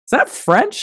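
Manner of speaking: high, baffled question; puzzled Englishman. Retold or imitated. Host voicing English locals hearing the Normans, high mock puzzlement. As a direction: ask it like you've never heard French. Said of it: "where they're like".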